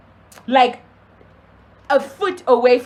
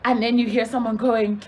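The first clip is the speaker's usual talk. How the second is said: ordinary delivery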